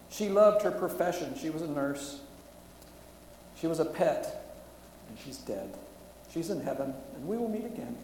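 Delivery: pushing through grief while reading aloud; emotional story reading. Real, not acted. Preacher reads a bombing survivor's account to the congregation, loud and strained.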